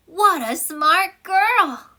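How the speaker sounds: high, loud, very slow praise; admiring exclamation. Shown as a demonstration. Teacher acting out an exclamatory sentence, loud and drawn out. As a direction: marvel at her out loud. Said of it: "exclamation point에서는 exclamatory라서".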